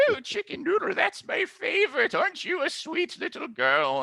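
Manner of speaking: very high, doting granny voice; delighted grandma. Acted. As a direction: coo in a squeaky old-lady falsetto. Game master plays delighted Grandma replying.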